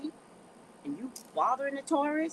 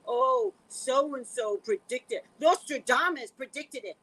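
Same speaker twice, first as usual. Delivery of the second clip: mocking quote; 2 semitones higher, 6 dB louder, slower than usual. Mocks those claiming credit later.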